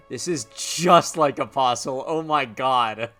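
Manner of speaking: slow, loud excited exclaim. Real, not acted. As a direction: gush over the comparison. Excited game comparison.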